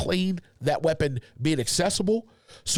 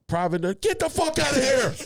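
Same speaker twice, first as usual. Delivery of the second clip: barked order, pitched way up; drill sergeant; 9 semitones higher than usual. Retold or imitated. Drill sergeant yelling at private.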